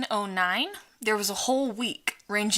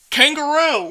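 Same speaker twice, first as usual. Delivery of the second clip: Jerry blurting; 7 semitones higher, 7 dB louder than usual. Retold or imitated. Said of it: "Jerry in the back's just like".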